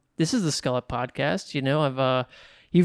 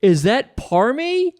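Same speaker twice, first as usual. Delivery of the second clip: slow questioning call; 8 semitones higher, 5 dB louder, much slower than usual. Retold or imitated. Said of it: "And I go".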